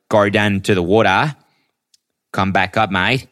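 hammed-up Aussie accent, "mate" lilting up; Australian accent. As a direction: play a chummy Australian at the barbie. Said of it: "Can't say that Australian".

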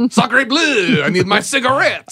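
mock Frenchman craving a smoke; French accent. Joke about the French smoking.

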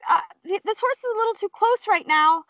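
high, hesitant, uneasy complaint; retold polite panic; nervous alarm. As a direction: pitch it up, worried but holding back. Uneasy as horse crowds her.

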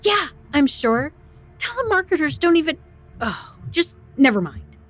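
exasperated. Acted. Frightened caller insisting to operator.

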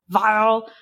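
ordinary talk, nothing marked

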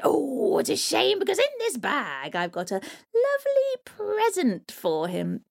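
high sly coaxing hag; troll hag. Acted. Troll hag tempts the boy, sly and coaxing. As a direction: dangle the present sweetly.

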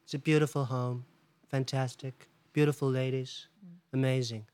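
slow, drawn-out Donald Trump voice; a hammed-up Trump praising everything; Trump impression. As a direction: pout and stretch every "beautiful" like Trump. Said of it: "how's your Trump".

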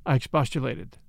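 ordinary delivery, nothing marked

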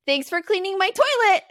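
put-on high, loud smug child voice; nephew's gotcha line; cheeky kid. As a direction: deliver it like a proud toddler. Child delivers punchline.

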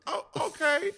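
high surprised oh okay. Retold or imitated. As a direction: act pleasantly taken aback. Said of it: "I was like".

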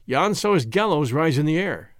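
ordinary delivery

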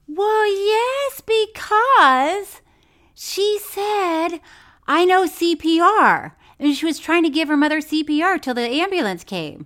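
high, loud, insistent; indignant yelling; heated yelling. Real, not acted. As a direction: raise your voice at her in protest. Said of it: "Don't you yell at me".

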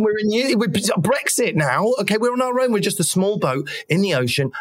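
high, hammed-up patriotic pleading; playfully overwrought defence of the nation; mock indignation. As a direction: protest in a high, put-on huff. Mock patriot defends Brexit Britain.

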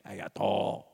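low, soft alien gibberish; Star Wars impression. Co-host repeating movie phrase stuck in head, Star Wars impression. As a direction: drop the pitch for film alien language. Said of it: "That's from Star Wars".

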